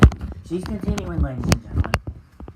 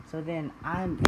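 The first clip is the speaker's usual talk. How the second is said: ordinary delivery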